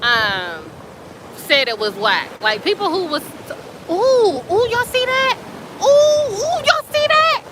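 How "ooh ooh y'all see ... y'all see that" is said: much higher, louder, thrilled squeals of discovery; pointing out something amazing, voice soaring up; excited. Real, not acted. Spots glowing bioluminescence in water.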